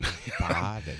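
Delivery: soft, light Canadian farted; mock Canadian. Cohost playing along, soft mock Canadian farted. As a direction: lightly put on a Canadian accent. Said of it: "with that Canadian accent".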